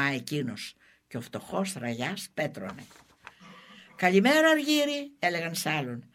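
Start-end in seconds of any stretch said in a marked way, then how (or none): 4.0-5.1 high-pitched taunting hello; mocking greeting. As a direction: sing it out high to needle him. Villagers taunt renamed renegade. (πειραχτικό, ψηλόφωνο καλημέρα)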